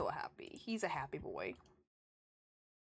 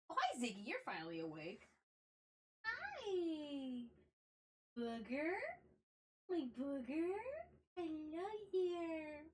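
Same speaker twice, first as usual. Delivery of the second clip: high, soft, drawn-out cooing; 9 semitones higher, 6 dB quieter, slower than usual. Real, not acted. Streamer greeting her cat who just woke up, high soft baby talk. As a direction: coo up high at a sleepy cat.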